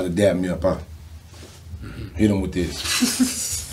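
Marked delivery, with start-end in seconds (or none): 2.2-3.7 laughing. Real, not acted. Laughs recalling dap up.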